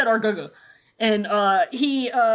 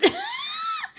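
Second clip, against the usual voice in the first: high shrieking laugh; 5 dB quieter than usual. Real, not acted.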